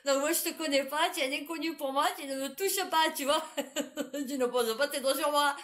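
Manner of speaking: a hint of a firm don't-touch-me, half laughing; lightly played telling-off through laughter; mock stern warning. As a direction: set the boundary in jest. Acted retort telling him off.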